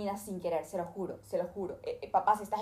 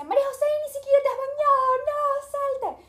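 shrill, much higher mock shout, louder and fast; dad yelling; 9 dB louder than usual. Father yelling at his late daughter, shrill shout. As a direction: screech it like a panicked father. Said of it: "llega gritando y dice".

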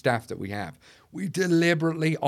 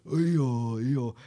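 lightly mocked donkey-like moan; Eeyore. Joking about gloomy people, mock Eeyore moan. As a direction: imitate a glum cartoon donkey. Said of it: "You know the Eeyore spirit".

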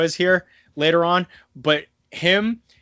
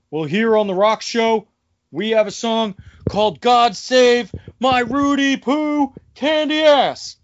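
The Rock; 7 semitones higher, 4 dB louder than usual. Retold or imitated. Wrestler taunting British Bulldog on his show, high hammed-up promo voice. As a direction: deliver it as a wrestler's taunt, stretching the last words. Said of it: "and he says".

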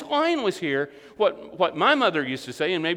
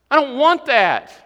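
firm declaration; 4 semitones higher, 7 dB louder, slower than usual. Acted. Preacher acting a believer's resolve.

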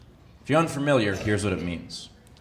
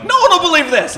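much higher, loud mock panic; panicked cop; 10 dB louder than usual. The imagined officer after the accidental shooting, high mock panic. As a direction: shriek it like a caught-out cop. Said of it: "He's like".

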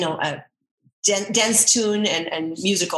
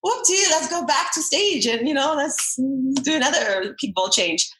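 high, chirpy mock voice, then slowing down; mock cheerful dancer; 5 semitones higher than usual. Dancer mocking her own comeback, high, chirpy voice. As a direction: play a breezy dancer who thinks nothing has changed.

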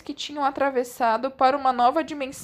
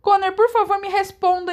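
1 pleading; 6 semitones higher, 5 dB louder than usual. A narrator's reading.

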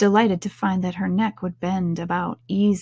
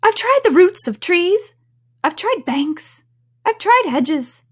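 high, fretful bird voice; exasperated pigeon; 10 semitones higher, 6 dB louder than usual. Acted. Pigeon listing hiding places to Alice, exasperated. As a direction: list each failed attempt in high-pitched complaint.

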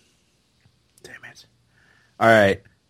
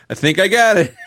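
higher, louder cocky voice; mock confident Han; 7 semitones higher, 5 dB louder than usual. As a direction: say it proudly. Quoting Han line.

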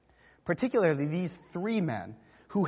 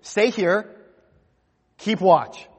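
louder, firm command to stay and keep watch; firm charge; 3 semitones higher, 4 dB louder, much slower than usual. Retold or imitated. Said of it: "he charges them".

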